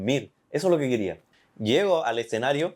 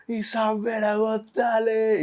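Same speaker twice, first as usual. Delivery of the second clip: confused stranger; 12 semitones higher, much slower than usual. Retold or imitated. Stranger names the show.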